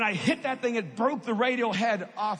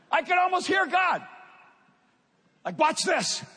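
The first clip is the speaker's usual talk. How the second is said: eager, raised telling; excited build; 5 semitones higher than usual. Acted. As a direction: lift the voice as the twist comes. Excited retelling of God's voice.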